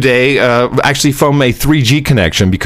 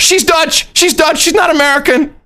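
high, frantic mock yelling; high panicked cry of she's Dutch; panicked shout. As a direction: yell it in a panic to shift blame. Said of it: "I immediately throw Mickey under the bus".

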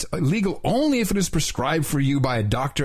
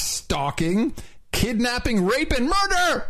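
building drama; 8 semitones higher, slower than usual. Acted. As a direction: build the list to a climax. Escalating list read dramatically.

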